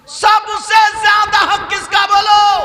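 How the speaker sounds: loud high call to the audience; calling out to crowd. Real, not acted. Preacher demands an answer from crowd, loud. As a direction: shout the question out to them. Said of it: "crowd call-out".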